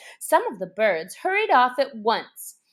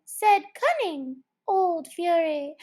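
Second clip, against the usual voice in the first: plain reading voice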